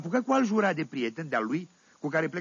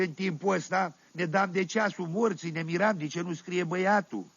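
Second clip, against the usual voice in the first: usual speaking voice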